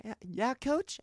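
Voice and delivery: put-on small rising "coach?" of a shy kid; timid seventh grader. Seventh-grade catcher answering his coach, timid. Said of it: "that's what seventh grade me sounds like".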